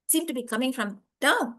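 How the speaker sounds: ordinary delivery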